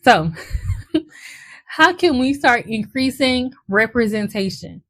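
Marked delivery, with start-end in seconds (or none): 0.4-1.0 a quick high-pitched giggle; high laugh. Real, not acted. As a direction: burst into a short high laugh. High laugh after apology.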